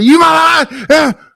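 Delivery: sudden high-pitched yell. Shown as a demonstration. User showing the group how they yell just to yell, yelling. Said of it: "I just yell sometimes just to yell".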